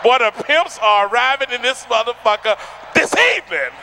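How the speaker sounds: normal talking voice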